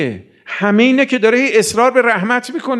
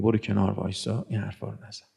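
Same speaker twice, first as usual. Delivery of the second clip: lower, much softer gentle reproach; hushed rebuke; 10 semitones lower, 13 dB quieter than usual. Retold or imitated. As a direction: hush him kindly aside. Imam voiced gently rebuking.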